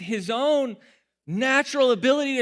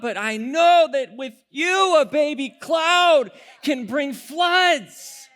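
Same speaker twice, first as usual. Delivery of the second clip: loud, slow, emphatic preaching; preaching at full force; 2 semitones higher, 4 dB louder than usual. Acted. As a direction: drive the faith point home. Preaches the point.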